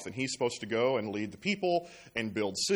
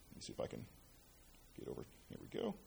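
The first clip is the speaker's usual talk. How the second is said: a muttered aside off-mic, much softer; whispered aside; 12 semitones lower, 18 dB quieter, much faster than usual. Real, not acted. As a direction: whisper to yourself while fixing the slide. Fiddling with the clicker aside.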